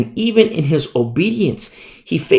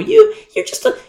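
much higher, squeaky sneer; scornful sneer. Acted. The crowd belittling the shepherd Amos, squeaky and scornful. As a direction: squeal your contempt.

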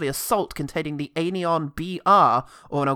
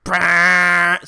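loud blurted noise; failed sound effect; 9 dB louder than usual. Pretend. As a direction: attempt a sound effect and flub it. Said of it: "See, I can't do it".

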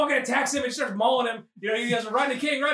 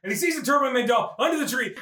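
usual speaking voice

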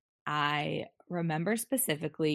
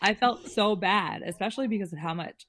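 higher, louder, amused and fast; laughing while talking; 6 semitones higher, 9 dB louder, faster than usual. Real, not acted. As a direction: laugh through the confession. Laughing over the wasted gyro.

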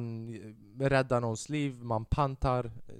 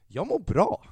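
mock cheerful; 8 semitones higher, 5 dB louder, slower than usual. Shown as a demonstration. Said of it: "så man ska säga när de frågar dig".

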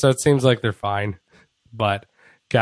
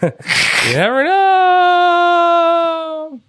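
very high, drawn-out mock uncertainty; high singsong; 4 dB louder, much slower than usual. Cohost teasing about a wrestler's return, high singsong. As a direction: stretch it out in a squealing falsetto.

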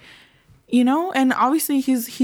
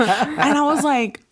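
laughing in disbelief; 5 semitones higher, slower than usual. Real, not acted. Brother's sacrifice story aftermath.

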